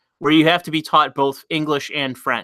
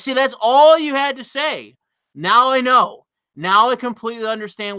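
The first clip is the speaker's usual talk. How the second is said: excited realization; 8 semitones higher than usual. Real, not acted. Streamer to chat, finally getting it, excited and exasperated.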